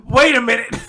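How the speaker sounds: sudden alarm. Retold or imitated. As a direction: cut yourself off with a loud outburst. Sudden realization in acted quote.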